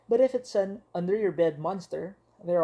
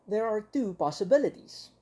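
ordinary delivery

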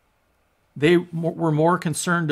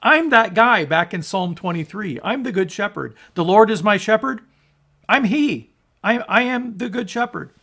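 higher, emphatic declaration as Jesus; Jesus speaking; 6 semitones higher, 5 dB louder than usual. Acted. Jesus declaring he is the psalm's shepherd, emphatic. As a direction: announce who you are, raised and insistent. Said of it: "Jesus is saying".

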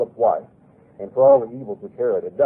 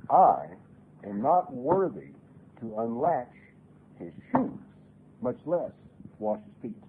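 humble quote; 3 dB quieter than usual. Acted. Preacher quoting John, hushed and humble. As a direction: let John's humility come through slowly.

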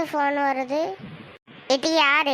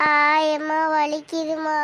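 slow, drawn-out hurt wail; whining in pain. Acted. Child hit by mother, whining in pain. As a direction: wail it slowly, trailing into a whimper.

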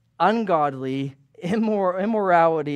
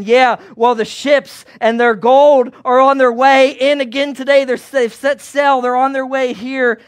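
higher, louder, breathless townsfolk chatter; excited gossip; 5 semitones higher, 6 dB louder than usual. Acted. A townsman chimes in about the king's gold, high and loud. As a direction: marvel at all the gold. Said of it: "Someone else chimes in".

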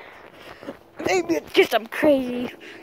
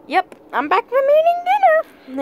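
much higher, sustained silly pitch; 13 semitones higher, 4 dB louder than usual. Pretend. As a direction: say it way up high, playfully. Repeats line in a silly voice.

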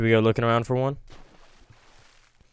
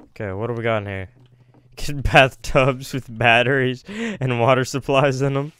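normal talking voice

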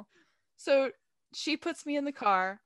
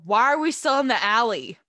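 annoyed loud question; 10 dB louder than usual. Retold or imitated. Demanding roommate explain.

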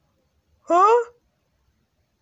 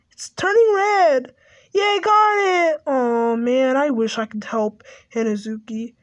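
usual speaking voice

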